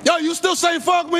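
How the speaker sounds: angry shout at the worker; yelling. Real, not acted. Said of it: "gonna yell at me".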